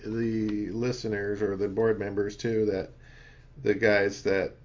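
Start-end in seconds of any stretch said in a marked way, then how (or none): none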